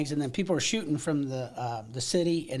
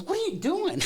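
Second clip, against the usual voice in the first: much higher, startled yell of a panicked soldier. Retold or imitated. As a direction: squeak it out like a shocked trooper in training. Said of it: "the guy goes".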